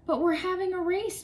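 high protesting yell. A narrator's reading. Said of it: "Tommy yelled".

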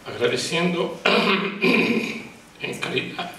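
slow, halting, throat clearing, words catching; reading her thanks at the Vatican, voice catching with emotion; halting emotional start. Real, not acted. A survivor thanking Monsignor Romero, voice catching and halting.

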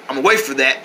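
usual speaking voice

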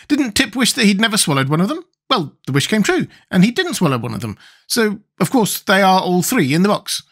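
high character voice working out a sum; Wogglebug, pedantic. Acted.

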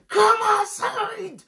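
very high, long drawn-out holler; imitated scream. Esther screams at auntie to come out, drawn-out holler. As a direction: stretch it into a screaming challenge. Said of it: "I'm screaming".

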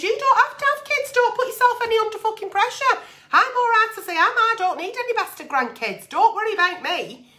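imitating a cheerful unbothered mum, high; mock breezy mother. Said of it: "and I'll just say".

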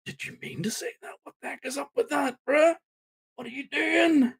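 hushed, high-pitched incredulous questions; mock stunned disbelief. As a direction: question a friend in hushed, squeaky shock. Voicing teammates' stunned looks.

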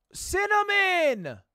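triumphant shout. Real, not acted. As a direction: shout the answer as it hits you. Solves the word at last.

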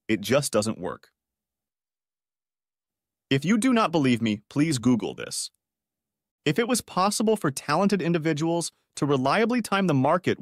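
ordinary delivery

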